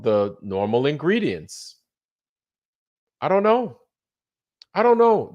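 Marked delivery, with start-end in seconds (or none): 3.2-5.3 high, loud disbelief; exasperated. Real, not acted. Fed up with this place, high and loud exasperation. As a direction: throw up your hands, much higher.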